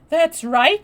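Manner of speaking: high and loud approval; excited approval. Acted. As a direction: cheer her on. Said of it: "she said".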